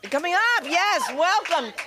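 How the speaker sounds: high, loud host's welcome; stage welcome. Real, not acted. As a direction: bring the next act up with a big, high voice. Host welcomes comic onto stage.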